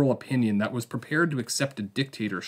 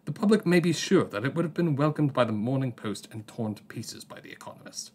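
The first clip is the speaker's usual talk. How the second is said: a mock English accent, higher with a lifted IS in each refrain; bad English accent; 4 semitones higher than usual. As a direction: quote the Economist editor in a hammed-up English accent. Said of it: "I'm gonna do my English accent again".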